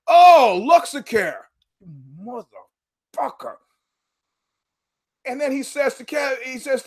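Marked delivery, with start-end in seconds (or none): 0.1-1.4 high, loud sudden dawning "oh"; mock realization. Service guy suddenly realizing what customer means, mock dawning. 2.2-3.6 slow, much softer, under the breath; muttered curse. Real, not acted. Fed-up customer muttering curse at service guy's cluelessness.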